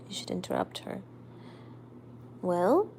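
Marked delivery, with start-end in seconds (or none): 0.1-1.0 unvoiced aside whisper. Real, not acted. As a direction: whisper it on the side. Aside about the cat.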